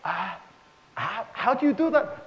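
high, impressed question; mock amazement. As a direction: gasp out the question in awe. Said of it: "They said".

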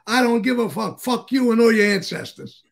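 mock furious yell. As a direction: blast him like the boss did. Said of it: "I would say".

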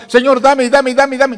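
high, loud, repetitive demands; hammed-up insistent pleading; mock begging prayer. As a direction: imitate someone nagging God for things. Mimics believers' vague demanding prayers.

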